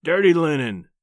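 acted rumbling husband; rumbled. A narrator's reading. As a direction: rumble it out as a gruff warning. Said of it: "he rumbled".

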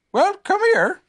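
high-pitched, calling out; Pooh. A narrator's reading. Pooh finds the caller outside, high loud voice. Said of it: "said Pooh".

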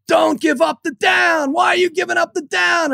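a furious football dad, pitch way up; screaming. Retold or imitated. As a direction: yell it at the coaches from the bleachers. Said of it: "And I'm screaming".

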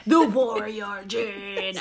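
epic voice cracking up mid-word; slow trailer voice with a laugh; announcer voice breaking into laughter. Pretend. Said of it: "I can't not say it in that voice".

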